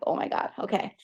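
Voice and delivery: a whispered oh my God, taken aback; whispered surprise. Retold or imitated. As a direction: whisper it, suddenly self-conscious. Whole bus listening to her.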